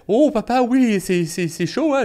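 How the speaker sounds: put-on high, surprised child voice; little boy's voice. Son telling his dad the candle is hot, high and surprised. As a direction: play the startled little son.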